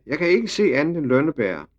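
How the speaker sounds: put-on high-pitched little girl calling down; yelling. A narrator's reading. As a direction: call it down from high up. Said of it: "skreg lille Ida".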